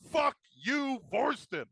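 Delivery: monster snarl. Acted. DM voices angry monster tree.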